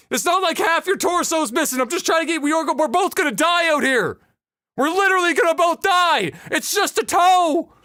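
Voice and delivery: high-pitched impatient protest; exasperated pleading. Acted. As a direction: reason desperately with a useless patient. Joe loses patience with injured man.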